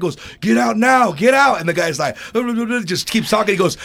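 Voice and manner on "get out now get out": high, loud mock yell; pissed-off umpire. Umpire kicking a player out, angry mock shout. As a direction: imitate an umpire losing his temper. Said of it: "The guy got all pissed".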